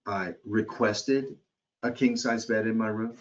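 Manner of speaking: "requested" drawn out slowly and stressed. Shown as a demonstration. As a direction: lean on "requested", drawing it out. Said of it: "the request was not noted".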